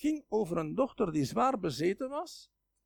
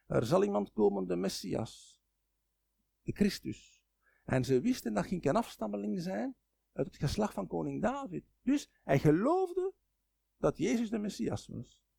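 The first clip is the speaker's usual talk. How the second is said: usual speaking voice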